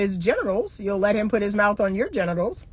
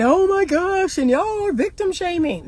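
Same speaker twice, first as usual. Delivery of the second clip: high, put-on indignant voice; hammed-up outrage at victim shaming; mock outraged critic; 9 semitones higher than usual. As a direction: mimic the offended critics. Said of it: "critics' complaint".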